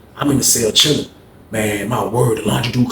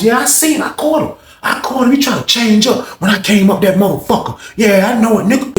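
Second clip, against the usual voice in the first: high, aggrieved tirade; indignant rant; 9 semitones higher than usual. Retold or imitated. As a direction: vent to a friend, worked up and high. Acts old head ranting to homeboy.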